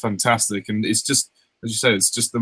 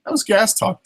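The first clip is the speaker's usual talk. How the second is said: higher cartoon outrage; mock outrage; 9 semitones higher than usual. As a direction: play it shocked. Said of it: "It's like".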